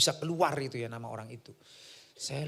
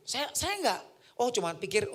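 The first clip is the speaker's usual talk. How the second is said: usual speaking voice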